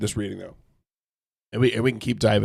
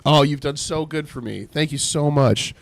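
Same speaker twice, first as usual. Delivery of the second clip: sarcastic thanks, a bit higher; mock gratitude; 5 semitones higher, 4 dB louder than usual. Job mocking his friend with sarcastic thanks. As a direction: overdo the gratitude, dripping sarcasm. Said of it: "He's being facetious here".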